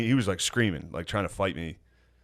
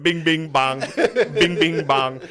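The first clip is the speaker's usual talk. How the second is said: louder, higher bing bong chant; lightly done Trump chant; Trump impression; 6 semitones higher, 4 dB louder, much slower than usual. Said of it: "Trump on full volume going".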